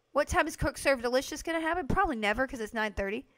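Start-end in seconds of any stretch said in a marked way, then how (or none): none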